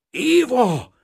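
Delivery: high, loud, drawn-out call of dread; terrified cry. A narrator's reading. Painter calling through the empty house, terrified. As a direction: cry out the name in inner panic. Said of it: "kiáltotta valami rettenetes belső ijegységgel".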